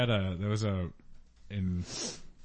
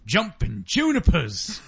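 put-on old-timey exclaim; 5 semitones higher, 3 dB louder, slower than usual. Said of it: "And you go".

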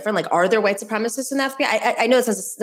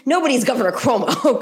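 laughing through the name, pitched up; 5 semitones higher than usual. Real, not acted. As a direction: chuckle out the punchline. Joking about Cuomo's uniqueness.

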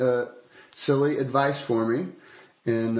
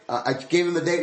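ordinary talk, nothing marked

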